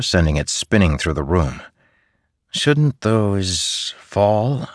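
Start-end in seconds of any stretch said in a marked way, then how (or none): 2.5-4.7 very slow, wondering mother; hesitant mother. A narrator's reading. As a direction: ask it slowly, puzzled.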